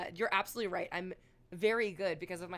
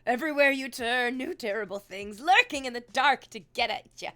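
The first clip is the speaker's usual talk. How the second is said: louder melodramatic ghost-story voice; mock dramatic horror; 3 semitones higher, 9 dB louder than usual. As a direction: ham up the lurking dangers. Mock horror about the outside world.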